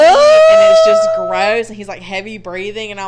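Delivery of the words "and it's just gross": disgusted. Real, not acted. Hugging clammy guy, disgusted.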